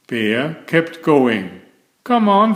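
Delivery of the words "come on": high, louder cheer; cheering cry. A narrator's reading. Bear cheering on fallen Franklin, calling out. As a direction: urge him on from the bike. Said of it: "he cried".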